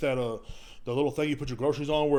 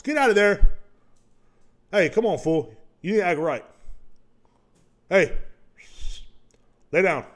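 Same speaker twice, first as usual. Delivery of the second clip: loud, higher scold; scolding the dog; 7 semitones higher, 8 dB louder than usual. Real, not acted. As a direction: command the dog to settle. Scolding his dog mid-recording.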